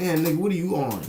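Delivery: high, mock-amazed question; incredulous quote. Asking his wasted friend, mock-amazed and high. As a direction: ask like you can't believe it. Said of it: "I was like".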